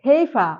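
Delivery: "ফেইফা" ending in an open a, no R; silent final R. Shown as a demonstration. Teacher showing the P sound to the class, silent final R. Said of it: "আরটাকে উচ্চারণ করছি না".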